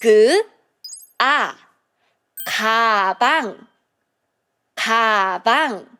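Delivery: 그 and 아 blended into 가방; teacher sounding out 가방; Korean syllable demo. Shown as a demonstration. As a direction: sound out 그, 아 then 가방 twice. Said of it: "the first syllable, it has 그".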